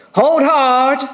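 high sudden warning call. Acted. Old sailor warning as the line strains, called out high.

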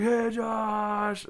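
put-on high, bright kids' shout of a name; excited kids. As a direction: call out like eager boys on a bus. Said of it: "And they're like".

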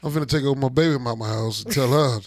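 normal talking voice

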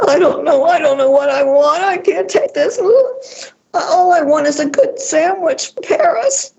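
high, tearful voice breaking into a cry; imitating crying woman. Retelling a crying client in the dressing room, tearful high voice. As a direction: play the distraught rich client on the verge of sobbing.

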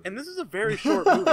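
high, fast, laughing delivery; laughing disbelief. Real, not acted. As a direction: rush it out through laughter. Laughing at how short it felt.

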